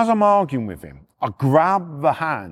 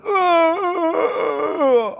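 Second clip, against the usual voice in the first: long high-pitched groan; imitated groan. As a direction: mimic a drawn-out cry of pain. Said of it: "I've heard".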